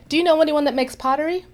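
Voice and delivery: high, eager asking voice; a friend calling out to strangers at bus stops. Retold or imitated.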